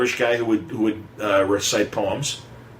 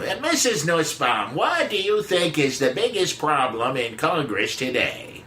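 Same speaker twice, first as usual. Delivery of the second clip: slow, higher Boston radio host; Boston accent; 5 semitones higher than usual. Retold or imitated. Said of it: "he had a Boston accent".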